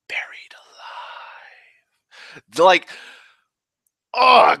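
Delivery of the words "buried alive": slow, unvoiced whisper of a villain's threat. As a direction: breathe the last words out as a slow menacing whisper. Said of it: "the quote before that is awesome".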